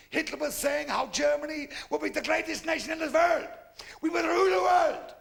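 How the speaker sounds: mock rally speechifying; ranting speech. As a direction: rant like a rally orator. Ranting Hitler speech retold.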